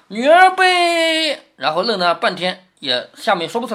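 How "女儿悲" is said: high, loud and hugely stretched syllables; drawn-out recitation. Acted. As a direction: proclaim it slowly, holding the last word high. 薛蟠卡住的酒令. (高声拖长腔念酒令)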